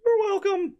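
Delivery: very high squeaky goblin voice. Acted. Goblin Prince voice.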